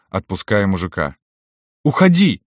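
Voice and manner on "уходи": high, loud, sharp cry; shouted. A narrator's reading. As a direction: shout it to send him away. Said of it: "крикнула она мне". (резкий высокий крик)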